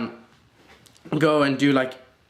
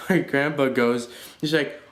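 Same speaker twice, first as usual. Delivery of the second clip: ordinary talk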